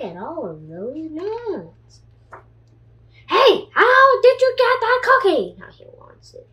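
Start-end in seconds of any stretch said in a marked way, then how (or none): 3.3-5.6 high, loud jealous shout; pigeon shout. Acted. Pigeon yelling at the duckling about the cookie, high and loud. 5.6-6.5 breathy secret remark; whispered aside. A narrator's reading. Reader whispering to the children about the jealous pigeon.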